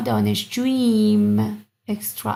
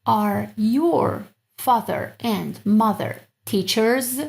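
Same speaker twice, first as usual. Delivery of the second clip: slow model; much slower than usual. Shown as a demonstration. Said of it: "slow translation".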